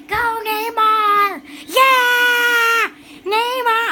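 loud, high, stretched-out fan yell; root for your player, building to a big "Yeah"; cheering shout. Real, not acted. Cheering on Neymar.

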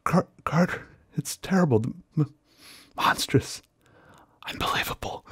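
frenzied, shaky whisper; trembling whisper. Acted. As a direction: whisper it in panic, breaking up. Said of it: "came the frenzied tones again".

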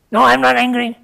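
loud, high mock denial; angry denial. Angry man denying his anger, loud high retort. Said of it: "he says".

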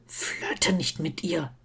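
slow, hushed warning hiss; low, irritated undertone; hissed in the ear. A narrator's reading. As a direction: hiss it softly into someone's ear. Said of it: "zischte er ihm ins Ohr".

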